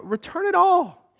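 very high, slowed command; climactic command. Acted. Nehemiah demanding restitution, high and climactic. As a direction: cry it out as the peak.

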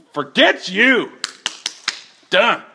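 high, loud snapping rejection; angry dismissal. Retold or imitated. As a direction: fling it away like a fed-up God. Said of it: "it's not this like".

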